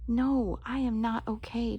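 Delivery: ordinary delivery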